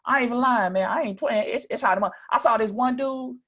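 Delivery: high, loud Mississippi country voice; Southern Mississippi accent. Shown as a demonstration. Introducing himself as a Mississippian in Phoenix, high Southern accent. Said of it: "if I talk like this?".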